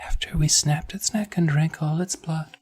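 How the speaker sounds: hushed sinister whisper; creepy whisper. Acted. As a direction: whisper it like a little ghoul. Nat's creepy confession.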